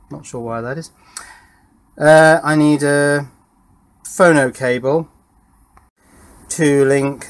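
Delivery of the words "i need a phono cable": usual speaking voice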